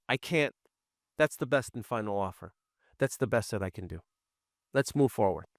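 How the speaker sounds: quiet, businesslike and final; no-nonsense. Shown as a demonstration. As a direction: show you mean business, calmly. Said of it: "now they see that you mean business".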